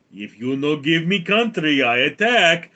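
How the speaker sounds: threatening mock Russian accent; Putin. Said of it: "And Putin goes".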